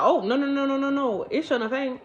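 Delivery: playful panicked refusal; mock alarmed refusal. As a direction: back away fast with a high voice. Refusing guy in retold scene.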